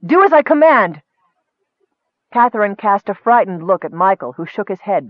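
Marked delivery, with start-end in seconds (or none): 0.1-1.0 high, loud imperious order; shout, demanding to be obeyed; raised-voice insistence; shouted command. A narrator's reading. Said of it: "Nicole shouted at her".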